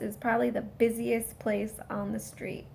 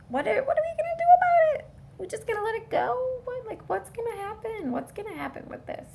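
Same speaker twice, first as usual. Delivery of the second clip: much higher, fast, incredulous; outraged disbelief; 11 semitones higher, faster than usual. Real, not acted. Vlogger asking viewers about Epstein, worked up and incredulous.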